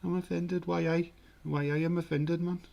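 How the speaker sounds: high-pitched Geordie indignation; mock-offended Geordie. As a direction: play offended in a hammed-up Geordie accent. Repeats offence in put-on Geordie.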